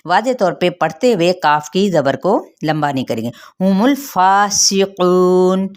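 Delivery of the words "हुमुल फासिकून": slow, sung-out हुमुल फासिकून; tajweed recitation, drawn out; recited phrase. Shown as a demonstration. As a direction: chant it with long held vowels. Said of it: "मीम की पेश को लाम के साथ".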